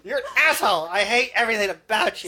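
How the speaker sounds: very high, loud hammed-up tirade; mock-furious insult. Teasing friend playing the angry partner, mock-furious. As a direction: shriek the insults in playful mockery.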